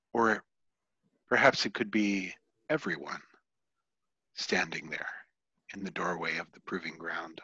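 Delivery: soft game master narration with long pauses; hushed scene setting. Acted. Game master describing a shadowed figure to players, hushed narration.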